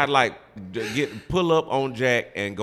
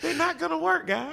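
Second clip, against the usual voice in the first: high mocking voice. Repeats the mock.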